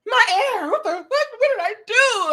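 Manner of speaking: high-pitched mock wail; hammed-up despair; distraught regret. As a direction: cry out like you lost everything.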